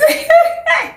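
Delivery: sudden high laugh; amused laugh. Real, not acted. Laughing at the dare to marry Bright, high laugh. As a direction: burst out laughing, high-pitched.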